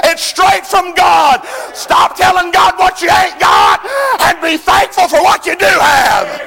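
loud, high, shouted sermon; fiery revival preacher shouting; full-force preaching. Acted. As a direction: preach it hard at the congregation.

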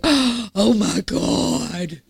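gasp, then very drawn-out mock horror; hammed-up scandalized gasp and slow exclamation; mock shock. Preacher imitating congregation reacting to wild guest speaker, mock shocked. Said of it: "things that you're gonna go".

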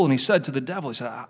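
normal talking voice

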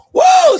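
high, loud cry of joy; ecstatic whoa. Real, not acted. Preacher in ecstasy before the church, loud whoa. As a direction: let a whoa burst out.

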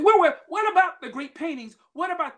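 high, loud startled outcry; alarmed protest. Retold or imitated. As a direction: cry out in disbelief. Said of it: "They said".

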